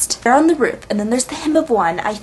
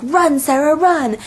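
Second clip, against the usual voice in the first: higher, hurried retold call; mock urgent cry; 5 semitones higher, slower than usual. Jonas throwing the cure to Sarah, acted urgent cry. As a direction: urge her to flee, lightly dramatized.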